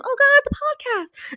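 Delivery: high, slow, wailing dread; dream-self panic. Retold or imitated. Recalling the dream, a high wailing dread. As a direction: moan it like a nightmare. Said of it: "and it's like, in my dream".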